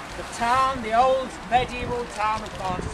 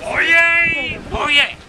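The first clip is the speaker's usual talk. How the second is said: loud, high crier's call; town crier; 7 semitones higher, 6 dB louder than usual. Acted. Town crier calling again.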